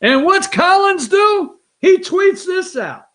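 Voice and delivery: high, loud, drawn-out disbelief; high-pitched, stretched-out amazement; incredulous. Real, not acted. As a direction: slowly exclaim it, scandalized. Incredulous setup of tweet story.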